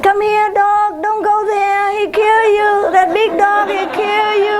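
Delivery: high, sing-song coaxing; mocking the neighbor's high sweet voice; mock high dog call. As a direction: coo warnings at the dog.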